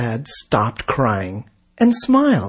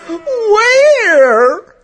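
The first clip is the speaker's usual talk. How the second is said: high, drawn-out wail; panicked question; 4 dB louder than usual. A narrator's reading. Sid asks the crowd about the fire, panicked.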